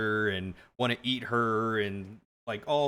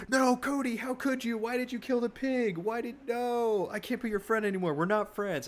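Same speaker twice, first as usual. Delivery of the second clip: much higher, hammed-up wail of betrayal; mock betrayed friend; 11 semitones higher than usual. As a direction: squeak out a fake heartbroken accusation. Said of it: "Kristen's like".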